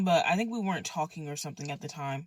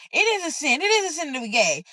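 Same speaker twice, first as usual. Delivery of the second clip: higher, insistent imitation of an angry woman; mock yelling; 9 semitones higher, 4 dB louder than usual. Church lady arguing with the storyteller, mildly yelled insistence. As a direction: insist on it, pitched up. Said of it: "yelling at me".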